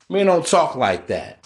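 normal talking voice

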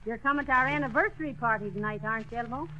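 normal talking voice

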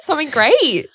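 building delight at the sign; excited. Retold or imitated. Growing excitement, retelling seeing forty-four everywhere. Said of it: "I'm like".

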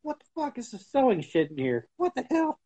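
high, outraged what the hell; mock disgusted surprise. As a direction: act out opening the tin. Retelling opening sewing tin.

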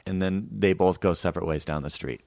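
normal talking voice

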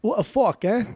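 high, hammed-up bad British accent; badly faked British lilt; awful British accent. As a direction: botch a British accent, pitched well up. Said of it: "that was an awful British impression".

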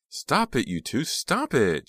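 very high, loud shriek. A narrator's reading. Jade shrieking at the fighting boys, loud shriek. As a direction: shriek at them to stop. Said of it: "Jade shrieked".

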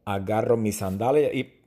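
ordinary talk, nothing marked

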